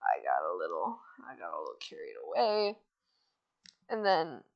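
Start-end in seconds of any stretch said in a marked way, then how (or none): 0.0-2.8 very low, soft admission; retold cat voice. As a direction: drop deep and quiet to own up. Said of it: "he comes in and he's like".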